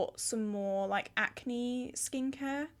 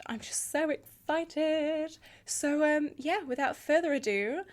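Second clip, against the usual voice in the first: overexcited babbling; 5 semitones higher, 3 dB louder than usual. Real, not acted. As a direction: squeal it out, drawn and giddy. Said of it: "I'm so excited".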